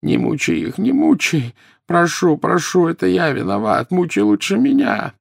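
high, anguished moan; wailing, pleading in pain; moaning plea. A narrator's reading. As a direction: moan and beg as if tortured. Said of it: "и простонал".

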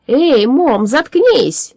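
loud, high, irritated yell; displeased shout. A narrator's reading. Alchemist silencing a noisy ghost, displeased shout. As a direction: shout crossly to silence someone. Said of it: "недовольно закричал Парацелиус".

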